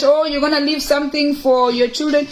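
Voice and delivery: ordinary talk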